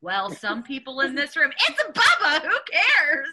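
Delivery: laugh-tinged quoting of the exchange; lively retelling. Retold or imitated. Replying to the pastor about Bubba, amused.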